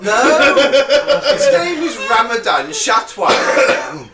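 loud, high, laughing protest; laughing insistence. Real, not acted. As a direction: insist while cracking up.

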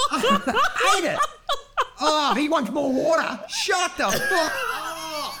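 high, stretched-out mock despair; hammed-up whining outburst; exasperated wail. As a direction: wail it like you're at breaking point. Acted rant of fed-up jail viewers.

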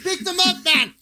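high imitation of the villain's accent; exaggerated Afrikaans-tinged voice; South African accent. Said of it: "Pick him up with your hands".

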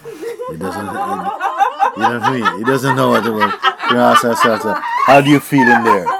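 laughing. Real, not acted. Amused by his Alka-Seltzer image, laughing out loud. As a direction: laugh through the words.

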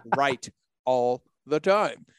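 slow, word-by-word retold complaint; playful fed-up outburst, heavily spaced; mock exasperation. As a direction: drag out each word in comic exasperation at being beaten. Mock exasperation at wife being right.

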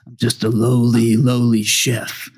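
slow, sly understated delivery; sly line. Retold or imitated. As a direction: play it cool and sly. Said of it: "tries his best to pull off, like, a sly line".

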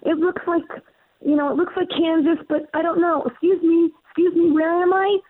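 put-on high, lost and bewildered girl voice; hammed-up Dorothy from Oz, high and lost; mock Dorothy voice. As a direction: play a confused Dorothy who landed somewhere strange. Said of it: "I was like".

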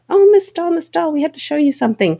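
high, eager imitation of a teacher running up; excited teacher calling out. Teacher rushes out to stop the departing author, excited calling out. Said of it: "came running out".

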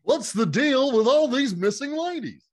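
high, loud standup comic voice; Jerry Seinfeld impression. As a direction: do a hammy Seinfeld bit.

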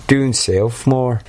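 put-on botched London accent, slow and stretched; terrible London accent. Friend joking about heading south, terrible London accent. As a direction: lightly attempt London and miss. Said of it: "That was a terrible London accent".

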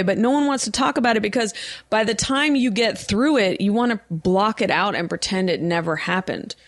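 usual speaking voice

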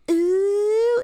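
high, loud, drawn-out crowd ooh; scandalized audience. Retold or imitated. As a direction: imitate an audience reacting to a burn. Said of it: "the audience is like".

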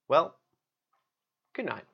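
ordinary delivery, nothing marked

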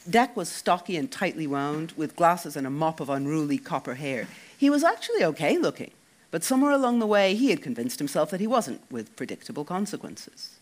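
ordinary delivery, nothing marked

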